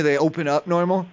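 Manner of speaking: normal talking voice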